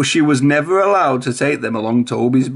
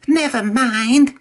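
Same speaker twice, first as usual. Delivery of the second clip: much higher, slow sly voice; whispering trucks; 10 semitones higher, slower than usual. A narrator's reading. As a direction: plot in secret. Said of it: "the trucks whispered to each other".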